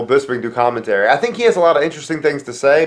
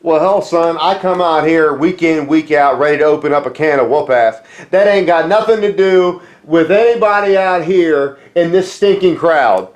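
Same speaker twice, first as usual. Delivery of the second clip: Stone Cold Steve Austin; 2 semitones higher than usual. Acted. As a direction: talk tough like a Southern brawler cutting a promo. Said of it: "Hey, Stone Cold Steve Austin".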